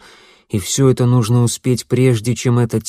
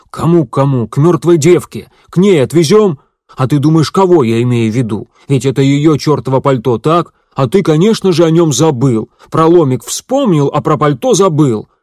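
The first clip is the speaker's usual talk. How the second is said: loud, higher furious rant; angry outburst; 5 semitones higher, 7 dB louder than usual. Acted. Bruno furious at Elias over the coat, shouting. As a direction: lay into him, furious. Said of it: "Бруно разозлился".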